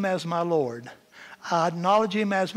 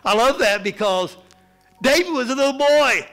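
loud, high, ringing preacher's climax; preaching at full force; 6 semitones higher, 6 dB louder than usual. Acted. Preacher exalting David to the congregation, full-force preaching. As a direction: lift the congregation with a big proclamation.

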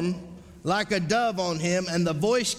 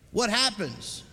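ordinary talk

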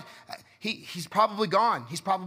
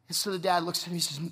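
ordinary delivery

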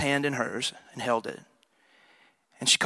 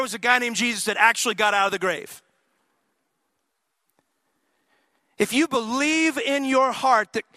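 higher, louder preaching voice; rising pulpit fervor; 9 semitones higher, 5 dB louder than usual. Acted. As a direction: preach it out with fire. Preaching at full force.

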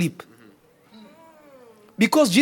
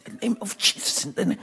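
breathy whisper, much softer; whispered prayer; 4 semitones lower, 10 dB quieter, much faster than usual. Real, not acted. Preacher whispering the prayer to the Father. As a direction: breathe the name like a secret prayer.

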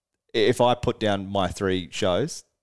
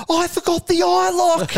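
much higher mock panicked squeal, louder; panicked Josh; 6 dB louder than usual. Bandmate confessing he forgot the eye lock, high mock panic. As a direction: squeal it like a bandmate who just realised. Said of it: "He just goes".

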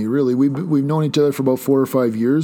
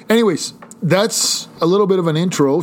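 higher, slightly raised voice; mild yell; 5 semitones higher than usual. Real, not acted. Host jumping back to the intro, mild yell. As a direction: burst back in with an accidental little yell. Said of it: "Sorry, I just yelled".

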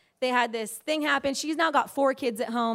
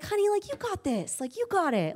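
breezy encouragement; 8 semitones higher than usual. Retold or imitated. Husband brushes off overwhelmed wife.

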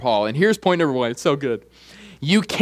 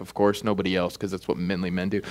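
low, soft throwaway aside; muted aside; 10 semitones lower, 8 dB quieter, faster than usual. Real, not acted. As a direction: drop it down, almost to yourself. Wry aside after admitting he cried.

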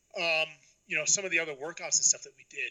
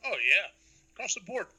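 chewing while talking; 3 semitones higher than usual. Real, not acted. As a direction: mouth full of ice. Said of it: "Sorry, I'm chewing some ice".

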